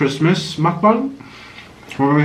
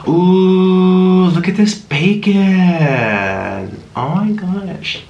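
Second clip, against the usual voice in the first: awed relish; 3 semitones higher, much slower than usual. Real, not acted. Marveling at the bacon.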